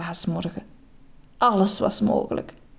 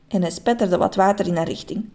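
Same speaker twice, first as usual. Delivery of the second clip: plain reading voice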